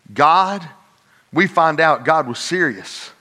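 ordinary talk, nothing marked

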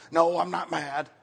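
mock strained calm, insisting she's fine; a tight, too-sweet denial. Mom in the car telling her toddler she's not mad. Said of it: "you know, in that voice".

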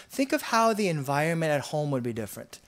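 ordinary talk, nothing marked

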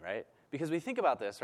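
usual speaking voice

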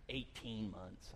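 soft slow Eighteen months; hushed repeat. Acted. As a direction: let eighteen months sink in. Repeats the delay.

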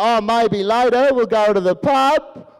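slow Australian-tinged lilt rising like a question; Australian-Northern Irish accent. Retold or imitated. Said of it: "between the Australian and the Northern Irish".

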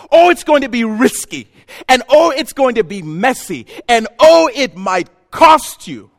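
loud, high, emphatic preaching; impassioned preaching. Real, not acted. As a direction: preach it at full force, hammering key words. Preaching at full force.

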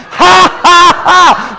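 high, staged "ha, ha, ha"; defiant mocking laugh. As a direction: laugh at the devil in punched ha's. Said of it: "Laugh at the devil".

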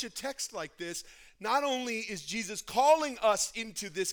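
loud, high, ringing sermon voice; full-force preaching. Acted. Pastor preaching to the church about Jesus, booming. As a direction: proclaim it to the whole congregation.

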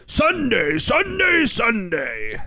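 Sunday, Sunday, Sunday! booming up high; monster truck ad. Pretend. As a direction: hype it like a monster truck rally ad. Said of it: "They should get the monster truck rally".

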